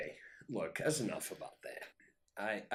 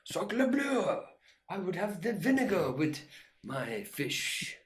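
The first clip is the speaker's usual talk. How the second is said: louder, higher hammed-up French accent; mock French accent; 8 semitones higher, 5 dB louder than usual. Joking about ordering fish, in a mock French accent. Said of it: "I should, you know".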